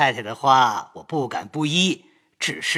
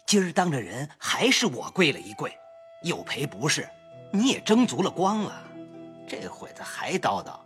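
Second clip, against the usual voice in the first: soft, lowered grumbling; muted reproach; 8 semitones higher, 10 dB quieter than usual. Acted. Husband quietly reproaching his crying wife, muted. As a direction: reason with her under your breath. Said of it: "贾琏道".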